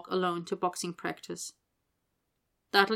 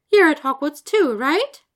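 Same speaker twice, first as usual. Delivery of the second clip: higher, louder, upbeat encouragement; encouraging; 8 semitones higher, 11 dB louder than usual. Acted. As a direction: cheer the shy boy on. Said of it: "Hestia Jones said encouragingly".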